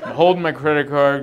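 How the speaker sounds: ordinary talk, nothing marked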